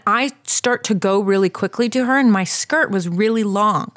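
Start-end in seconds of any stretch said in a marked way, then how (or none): none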